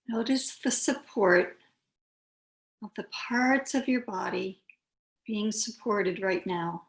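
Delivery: unhurried yoga teacher, long pauses between phrases; calm, spacious meditation voice; guided meditation. Real, not acted. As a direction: guide them gently into stillness, phrase by phrase.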